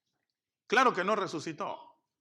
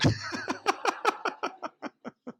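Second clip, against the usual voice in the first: laugh; 13 semitones higher than usual. Real, not acted. Laugh after Jesus' line.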